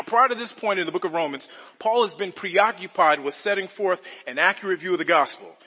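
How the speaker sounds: ordinary talk, nothing marked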